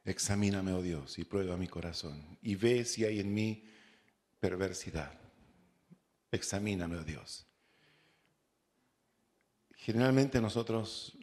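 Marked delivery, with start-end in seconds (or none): 0.0-7.5 soft, low, reverent plea to God; hushed prayer. Acted. Preacher voicing a psalm prayer, hushed and reverent. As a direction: pray it quietly, as if alone before God. Quoted prayer read in sermon.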